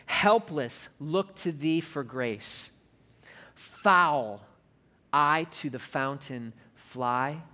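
slow, measured recital of a hymn verse; hymn recitation. Acted. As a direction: let each line of the hymn settle, slowly.

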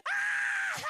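very high, loud laughing shriek. Real, not acted. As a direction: scream with laughter. Sister falling out laughing.

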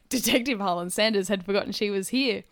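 usual speaking voice

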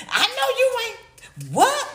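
high, slow mock shriek; siren-like shriek. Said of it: "We sound like a little, uh, siren".

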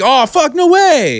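high-pitched dismayed gasp; sudden shocked disbelief. Retold or imitated. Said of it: "I was like".

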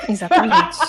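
loud laughing agreement; laughing exclamation. Real, not acted. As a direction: burst out laughing as you agree.

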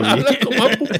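laughing. Real, not acted. Laughing while telling.

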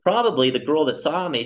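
normal talking voice

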